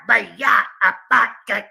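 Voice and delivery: Abaya said loud and drawn out, mocking a made-up Hebrew sound; mocking their pronunciation. Mocking how the opponent says Hebrew, loud mock pronunciation. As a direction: sneer out Abaya, abaya like a bad imitation. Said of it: "this is how they say it".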